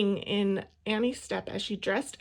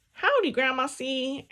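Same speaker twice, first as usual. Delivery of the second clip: louder bellowed greeting; 3 semitones higher, 6 dB louder than usual. A narrator's reading. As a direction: bellow it over the piano. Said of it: "Annie bellowed".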